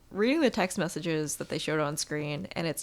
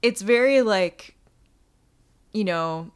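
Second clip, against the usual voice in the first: ordinary talk, nothing marked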